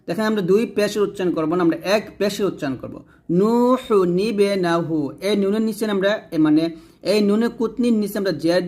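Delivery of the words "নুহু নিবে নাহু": slow recited নুহু নিবে নাহু with noon qutni, single pesh; Quranic recitation demo. Shown as a demonstration. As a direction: chant নুহু নিবে নাহু slowly, joining with a small noon. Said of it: "এরকম ভাবে আমরা পড়বো". (ধীরে তিলাওয়াত করে নুহু নিবে নাহু)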